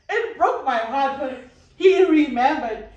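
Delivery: slow, welling up with real feeling; real emotion. Real, not acted. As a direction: let the hurt break through, slowly. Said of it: "why am I getting emotional".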